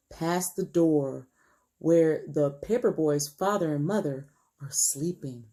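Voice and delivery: ordinary delivery, nothing marked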